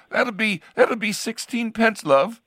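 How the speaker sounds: high, mock English lady's voice; female bus driver. Recalling an English bus driver, high mock lady's voice. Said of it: "the bus driver, if she was a, a woman, would say".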